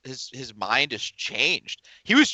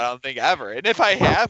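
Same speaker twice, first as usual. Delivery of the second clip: ordinary delivery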